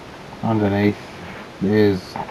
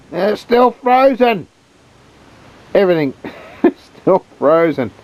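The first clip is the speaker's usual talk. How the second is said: incredulous; 10 semitones higher than usual. Real, not acted. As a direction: stretch it out in disbelief. Amazed that the chicken is frozen.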